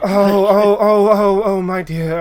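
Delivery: high, loud flustered oh-oh; startled gasps. Acted. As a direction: panic as the flower goes off. NPC startled by poof.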